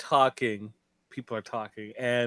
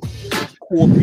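louder, whispered New York Coffee; Rosie Perez accent; 2 semitones higher, 9 dB louder than usual. Retold or imitated. Cohost mimicking the accent, whispering the word Coffee. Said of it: "That's Rosie Perez".

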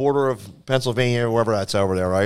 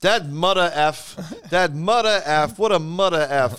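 higher, drawn-out comic grumble; exasperated club owner; 5 semitones higher, slower than usual. Retold or imitated. Club owner curses last week's headliner, exasperated and higher-pitched. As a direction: stretch the swear out in mock disgust. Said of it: "the owner is an Iranian gentleman".

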